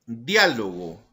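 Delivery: "Diálogo" with the weight on DIÁ, the first syllable; stress on DIÁ. Shown as a demonstration. Teacher gives "Diálogo" as an example, stressing DIÁ. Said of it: "Tenemos otro ejemplo".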